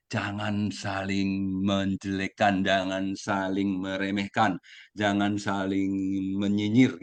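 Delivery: drawn-out, deliberate warning; slow admonition. Real, not acted. Speaker urging fellow members not to belittle each other, slow admonishing. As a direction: spell out each caution slowly.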